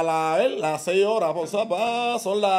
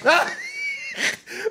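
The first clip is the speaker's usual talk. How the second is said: high shrieking laugh. Real, not acted. Bursting laugh at friend.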